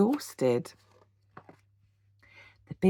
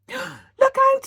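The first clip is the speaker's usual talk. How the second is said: much higher, louder cry; terrified cry; 8 dB louder than usual. A narrator's reading. Horace warns Boris, crying out in terror. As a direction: scream the warning. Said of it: "he cried".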